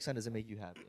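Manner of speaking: soft, tender fatherly question; emotional dad. Retold or imitated. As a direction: ask it gently like a moved father. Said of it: "make it emotional".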